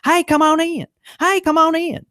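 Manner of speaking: high, loud parroted shop welcome; a customer repeating his hello to tease him; mocking his greeting. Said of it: "they were just like".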